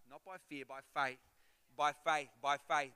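ordinary talk

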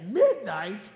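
loud, incredulous echo question; mock astonishment. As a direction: retell your disbelief with a punch. Retold astonished reply to pastor.

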